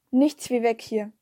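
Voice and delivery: usual speaking voice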